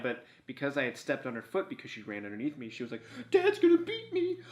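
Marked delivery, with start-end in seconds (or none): 3.3-4.4 very high, squeaky mock whimper; frightened dog voice. Owner voices his stepped-on dog cowering, hammed-up high fearful voice. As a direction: play a scared little dog, pitched right up. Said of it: "She yelped and cried".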